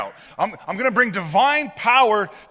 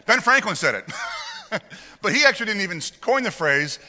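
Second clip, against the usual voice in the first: laughing. Real, not acted. Laughing at his own reveal.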